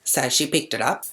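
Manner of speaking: normal talking voice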